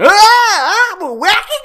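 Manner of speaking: very high, loud goofy whooping; hammed-up party clown shout; wacky gorilla-suit clown. Mocking a partygoer in gorilla suit, wacky high holler. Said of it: "in a gorilla suit".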